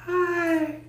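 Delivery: very high giddy greeting; high excited voice. Real, not acted. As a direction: squeal a hello.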